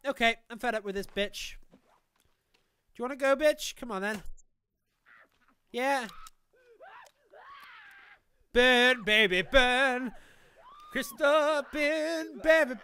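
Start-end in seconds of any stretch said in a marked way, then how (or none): none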